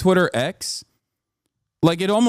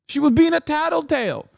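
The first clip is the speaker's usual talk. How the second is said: mock tattletale; 10 semitones higher than usual. Mocking the tattletale.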